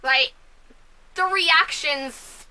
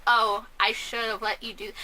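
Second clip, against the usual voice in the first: lower, unbothered mock reaction; imitating a mother with no emotion; not freaking out; 4 semitones lower, 4 dB quieter than usual. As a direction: shrug it off, emotionless. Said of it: "she's not freaking out or anything".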